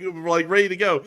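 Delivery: ordinary delivery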